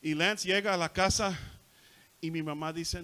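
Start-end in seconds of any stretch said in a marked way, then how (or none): none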